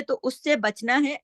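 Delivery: normal talking voice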